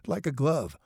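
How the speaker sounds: chuckle, then say it; chuckling. A narrator's reading. Mother agreeing with her son about dad, chuckling. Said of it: "Sally Redmond chuckled".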